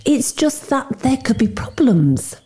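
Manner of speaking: high, slow defensive explanation. A narrator's reading. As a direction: explain yourself cautiously, on the defensive. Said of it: "he has me on the defensive".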